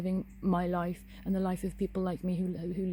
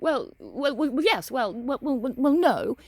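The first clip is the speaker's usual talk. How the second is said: mock-flustered, higher, tripping over well; flustered stammer; 5 semitones higher, 6 dB louder, slower than usual. Mimicking the woman backtracking after admitting yes, flustered stammer. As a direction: imitate someone scrambling to cover a gaffe. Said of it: "She went".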